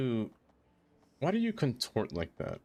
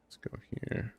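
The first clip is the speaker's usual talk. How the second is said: unvoiced whisper to herself; soft private whisper; 7 dB quieter than usual. Real, not acted. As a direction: breathe the words under your breath.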